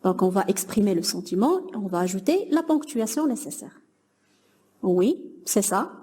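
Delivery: ordinary delivery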